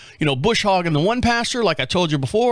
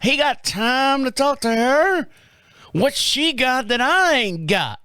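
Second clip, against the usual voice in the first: higher, slow Southern drawl, laughing at the end; 7 semitones higher, slower than usual. Retold or imitated. Asking what the neighbor lady has, in a Southern drawl. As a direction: drawl it out Southern, mock-jealous. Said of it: "Somehow I got some kind of southern drawl here".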